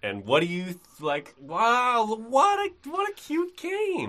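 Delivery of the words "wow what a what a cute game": gushing. Acted. Gushing over a cute game.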